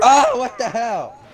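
high, slow startled outcry; surprised. Real, not acted. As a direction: caught off guard, drawn out. Surprised by explosion in game.

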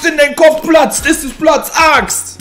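high, loud hype shout; over-the-top shouted opener; shouted intro. Acted. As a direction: yell the show intro like a hype man. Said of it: "an diesem grandiosen Intro".

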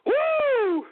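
high excited woo; high whoop. Real, not acted. Whooping after dancing.

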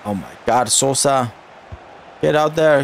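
normal talking voice

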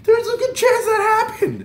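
very high, loud squeal; frantic disbelief. Retold or imitated. Fan realizing he passed his idol, frantic squeal. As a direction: squeak it out, overwhelmed. Freaking out over near encounter.

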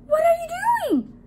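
high, loud shout of a startled donut; alarmed shout. A narrator's reading. Donut about to be eaten by his owner, alarmed shout. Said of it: "shouted Arnie".

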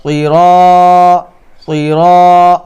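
"সিরা" with thick s and long rounded "raw"; heavy emphatic S. Shown as a demonstration. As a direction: hold "সিরা" slow and full. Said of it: "কি বলবেন?".

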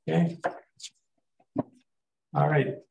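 ordinary talk

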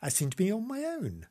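ordinary talk, nothing marked

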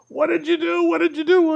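high, loud repeated demand; imitating her frantic yelling; mock frantic shout. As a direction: shout it as she rushes down. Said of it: "she's coming down going".

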